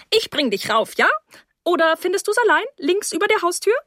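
high hostess voice, climbing and quickening; brisk hostess. Acted. Mrs Rose sends guest upstairs, high brisk hostess voice. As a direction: offer help, then go high and quick.

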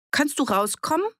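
high call through a door. A narrator's reading.

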